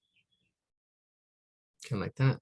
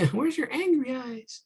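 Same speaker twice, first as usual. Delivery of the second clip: playful high voice; 3 dB louder than usual. Pretend. Playful aside about angry eyebrows.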